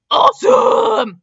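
very high, loud, slow shout; hammed-up kids yelling. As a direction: scream it with glee. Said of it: "the twins yelled".

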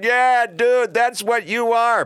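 high, loud mocking voice of a know-it-all critic; mocking simpleton. Mimicking simpletons taunting the host, high and mocking. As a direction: sneer it like a smug heckler. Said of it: "simpletons are out there going".

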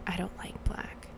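imitated whisper, barely audible; very quiet. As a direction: whisper it as the quiet nail technician. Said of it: "the woman was so quiet".

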